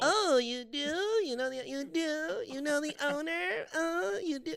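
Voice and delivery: high, slow, snooty mocking voice. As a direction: drawl it back at her like a snob. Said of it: "mocking the woman at the restaurant".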